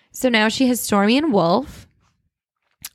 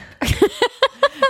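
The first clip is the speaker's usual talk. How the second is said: high laugh. Real, not acted. Laughs at burping tease.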